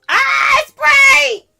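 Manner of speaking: high, loud burst of joy; excited shout. Real, not acted. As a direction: shout it out in sudden delight. Spray finally works after struggle.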